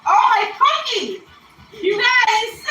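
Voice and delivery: high, loud delighted cry; excited squeal. Real, not acted. To her little dog Cookie, a loud happy squeal. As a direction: greet the dog with a burst of joy.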